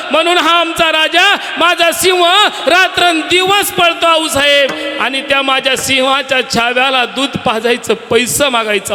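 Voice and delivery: high, loud, fervent outpouring; impassioned plea. Acted. Mother pleading before Jijabai, high fervent shout. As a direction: pour out devotion to the king, rising to a shout.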